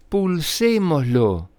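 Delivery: eager slow call; excited shout. A narrator's reading. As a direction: cry it out together. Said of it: "gritaron al unísono".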